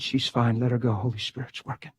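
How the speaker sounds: low, soft and settled; steady the room quietly; calm reassurance. Retold or imitated. Preacher calming the congregation during the seizure, low and soft.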